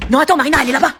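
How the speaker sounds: loud call to mislead; shouting. Acted. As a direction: shout it across the room.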